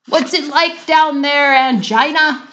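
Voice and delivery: high, loud call down the hole; husband calling down to his wife. Acted. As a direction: call down into the chute. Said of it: "he called out".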